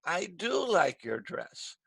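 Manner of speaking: slow correction, weight on "do"; long, emphatic "do", the rest falling away; stress on DO. Shown as a demonstration. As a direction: stretch "do" with a rise and fall. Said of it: "Wilmer is correcting".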